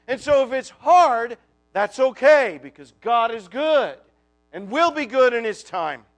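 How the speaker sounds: loud, driving sermon climax; preaching at full force. Acted. Preacher rallying the faithless heart, loud and fervent. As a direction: proclaim it over the congregation.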